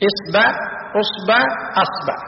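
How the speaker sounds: إصبع، أصبع، أصبع as isba', usba', asba'; fatha on the ba. Shown as a demonstration. Teacher lists finger variants, إصبع، أصبع، أصبع each ending -ba'. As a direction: list إصبع، أصبع، أصبع changing the first vowel, a after b. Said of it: "بفتح الباء مثلثة الهمز".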